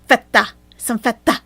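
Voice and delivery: "Feta" in a loud mock Arabic accent, a rolled mock "Fehta". Streamer mimicking Chantal's accent on "Feta", loud and mocking. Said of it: "What accent is that, Chantal?".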